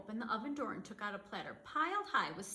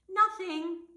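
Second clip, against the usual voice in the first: excited exclamation; 9 semitones higher, 10 dB louder than usual. A narrator's reading. Disappointed dreidel result.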